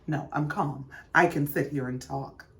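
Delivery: sudden calm. Retold or imitated. Karen suddenly calm after flash.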